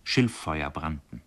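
usual speaking voice

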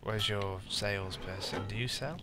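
ordinary talk, nothing marked

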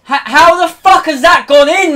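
high, loud, stunned yell; real indignation; outraged disbelief. Real, not acted. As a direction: shout it at the screen. Conceded goal outrage.

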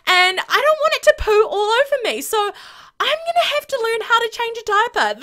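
high, fast, frantic new-dad voice; panicked David. Pretend. David the Sim panicking about the new baby, high and fast. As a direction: gabble it out like a terrified father-to-be. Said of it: "He's like".

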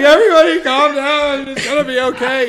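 high, loud, cracking plea; voice breaking. Retold or imitated. Twenty-five-year-old calming angry crowd, high cracking voice. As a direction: squeak it out, voice cracking upward. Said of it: "my voice was still breaking".

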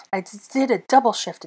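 slight stutter through clenched teeth. A narrator's reading. Shivering witch explains her exhaustion, clenched teeth. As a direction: stumble on the first word, teeth clenched. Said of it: "through her clenched teeth".

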